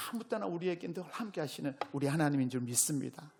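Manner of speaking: ordinary talk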